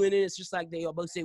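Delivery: ordinary delivery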